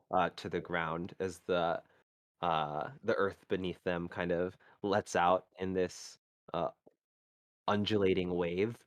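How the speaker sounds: scene-setting storyteller voice; game master narration. Acted. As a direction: set the scene for the players. GM describes the battle scene.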